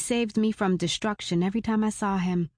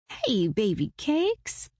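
cooing; 3 semitones higher, much slower than usual. A narrator's reading. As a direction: coo it sweetly to a baby. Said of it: "I cooed".

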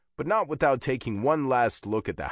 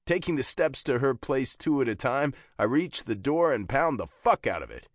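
usual speaking voice

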